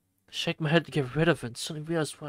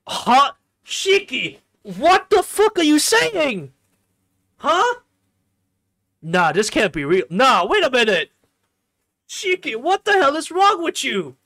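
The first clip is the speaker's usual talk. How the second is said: very high, loud, incredulous screaming; outraged yelling; 11 semitones higher, 12 dB louder than usual. Acted. Player scolding Shiki for his behavior, yelling in outrage. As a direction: scream in disbelief, stretching the words out. Outraged reaction yelled at the character.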